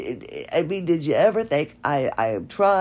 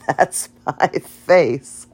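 laughing; 5 dB louder, much slower than usual. Real, not acted. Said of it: "laughs at own comparison".

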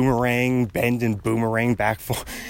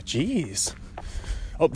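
ordinary talk

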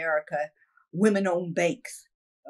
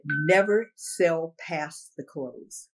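slow, deliberate advice; 3 semitones lower, much slower than usual. Retold or imitated. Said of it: "She said".

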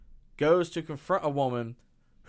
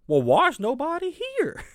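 much higher, louder ditzy whine; mock dim character; 12 semitones higher, 5 dB louder than usual. Host mocks a movie teen asking where everyone is, high ditzy whine. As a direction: play the clueless teen, puzzled.

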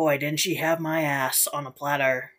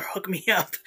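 ordinary delivery

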